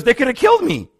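high, loud imitation of alarm; retold alarm. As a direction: exclaim it like the startled convert, pitch jumping up. Retold convert realizing his danger.

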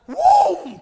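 sudden high, loud "whoom"; explosive high-pitched swoop sound effect; swooping whoosh noise. Shown as a demonstration. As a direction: imitate something diving out of the sky. Imitates a bird swooping on teenager.

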